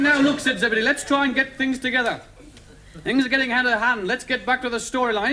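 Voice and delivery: high, insistent storybook character; exasperated Zebedee. Acted. Zebedee getting things back to the storyline, insistent. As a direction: call everyone to order in character.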